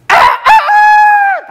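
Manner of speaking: very high, long crowing "아, 아, 아"; rooster crow. Shown as a demonstration. Priest imitates the rooster crowing after Peter's denial, very high drawn-out crow. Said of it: "닭이".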